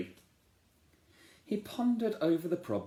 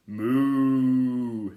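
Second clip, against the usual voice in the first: a long, loud, drawn-out moo; a pig mooing like a cow, loud and long; 2 semitones higher, 9 dB louder than usual. Acted. Pig moos in story.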